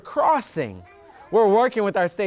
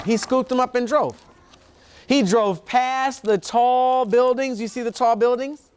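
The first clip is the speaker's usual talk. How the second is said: put-on reading to children; 6 semitones higher than usual. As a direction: draw little ones into the picture. Reads book, higher voice to kids.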